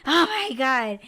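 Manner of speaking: loud, acted shock on waking; startled awake. Podcaster acts out waking from a cliff-fall dream, loud startled outburst. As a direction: blurt it out as if jolted from a nightmare. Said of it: "it wakes us up, and we're just like".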